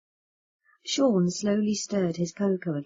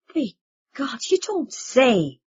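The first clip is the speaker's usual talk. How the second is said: higher, slow astonished cry; surprised exclamation; 6 semitones higher, 5 dB louder, slower than usual. Acted. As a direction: gasp it out in disbelief. Sean startled exclamation.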